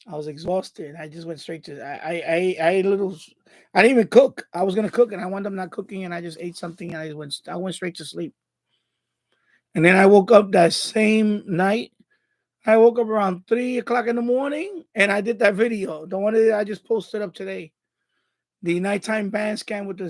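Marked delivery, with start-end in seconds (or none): none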